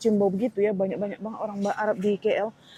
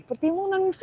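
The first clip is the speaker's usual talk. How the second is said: much higher, tickled and teasing; playful coinage; 9 semitones higher than usual. Real, not acted. To viewers, amused by a land of cucumbers, pitched high. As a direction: show amused disbelief at all the cucumbers.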